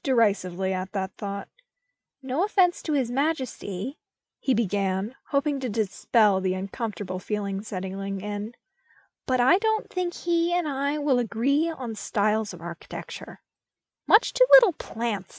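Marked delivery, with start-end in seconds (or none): 2.2-4.0 put-on higher, brighter young voice; light youthful tone; Luke's voice. A narrator's reading. Luke trying to lift the mood, higher young voice. 9.3-15.4 put-on higher, brighter young voice; light youthful tone; Luke's voice. A narrator's reading. Luke trying to lift the mood, higher young voice.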